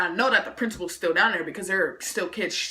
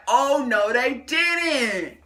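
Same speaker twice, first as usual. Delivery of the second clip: loud, high, drawn-out indignation; hammed-up "oh no they didn't"; mock outrage; 5 semitones higher, 3 dB louder, much slower than usual. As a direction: play up sassy disbelief, raised and loud. Acted outrage at being blamed.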